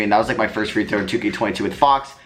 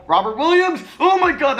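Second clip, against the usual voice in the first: yelling the player's name in excitement; excited shout. Real, not acted. Calling a risky pass.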